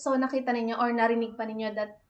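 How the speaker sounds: ordinary talk, nothing marked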